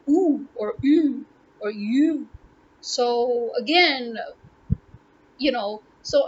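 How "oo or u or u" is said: "U" as oo, French u, then English you; original U sounds. Shown as a demonstration. Explaining the letter U to viewers, original pronunciations demonstrated. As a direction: step through three versions of "U". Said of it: "original pronunciation is".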